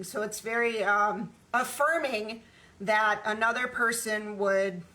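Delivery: normal talking voice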